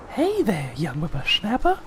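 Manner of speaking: put-on high, cranky old-timer voice; squeaky old codger calling out; old prospector. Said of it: "I know that's a terrible impression".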